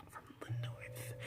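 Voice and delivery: soft unvoiced aside; whisper. Real, not acted. Viewer aside about the master, whispered. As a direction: breathe it as a secret.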